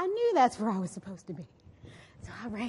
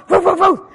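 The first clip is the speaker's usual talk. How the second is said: loud, raised dog barks; dog barking; 6 semitones higher, 16 dB louder than usual. Retold or imitated. The dog barks behind her as she runs, loud. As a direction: bark like a chasing dog.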